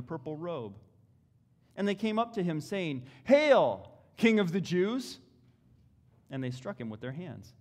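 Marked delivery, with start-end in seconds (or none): 3.2-5.2 loud, high mock salute; mocking hail. A narrator's reading. Preacher voicing soldiers jeering at Jesus, loud mocking cry.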